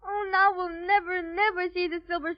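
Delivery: crying through the words; tearful wailing. Acted. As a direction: break into a despairing child's sob. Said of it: "don't carry on like that".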